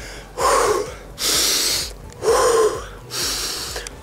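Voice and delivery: long breath blown out through the mouth; exhale through mouth. Shown as a demonstration. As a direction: push the air out of your mouth. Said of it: "breathe like that".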